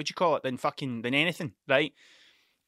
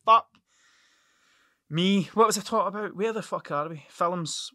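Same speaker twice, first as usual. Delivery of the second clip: ordinary talk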